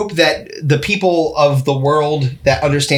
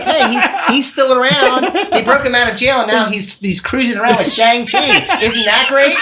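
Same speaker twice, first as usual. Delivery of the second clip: mock cheerful studio; 6 semitones higher than usual. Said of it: "They're like".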